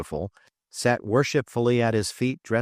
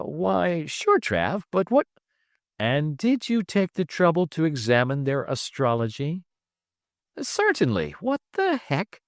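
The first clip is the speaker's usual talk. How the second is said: put-on higher eager young voice; puzzled youngster, pitched up; young Trip; 7 semitones higher than usual. Trip answers Travis, puzzled, in a higher young voice.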